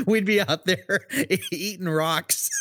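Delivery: laughing through the words; words broken up by real laughter. Real, not acted. Joking about swallowing gravel.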